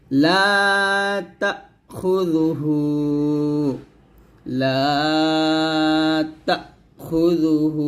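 long লা, short তা, held final "hu" in তাখুযুহু; slow recitation with a catch after তা; one-alif stretch. Shown as a demonstration. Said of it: "হুতে এক লিফ টান দিবেন". (লা আর হু টেনে, তা থামিয়ে পড়া)

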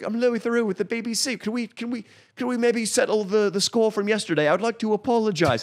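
high, prim mock BBC presenter; polite nervous apology, hammed up; Louis Theroux impression. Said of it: "Louis Theroux is like".

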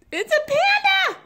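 loud, very high squeal; high-pitched thrilled exclamation; delighted squeal. Real, not acted. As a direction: burst out with joy at a surprise. Delighted discovery of gift.

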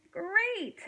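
high, exclaimed, mildly exasperated. A narrator's reading. Hank with a cup stuck to his face, exasperated. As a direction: exclaim it in storybook annoyance.

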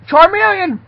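high, loud shout of a name; outraged shout. Acted. Boss calling out Charmeleon in outrage, high shout. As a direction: yell it out in high-pitched outrage.

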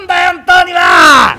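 slow, loud, high rough shout. Acted. Kuma yells at the bedridden young master, loud rough shout. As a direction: shake him awake with your voice.

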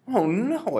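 high, loud dismayed cry; the boy is crushed by the news; cried out. Acted. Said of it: "I cried".

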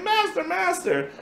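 high, calling voices; pleading maidens. Acted. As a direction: beg to be let in.

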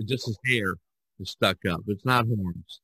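ordinary delivery

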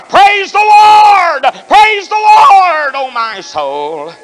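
high, slow, loud shout of praise; preaching at full force; ecstatic shout. Real, not acted.

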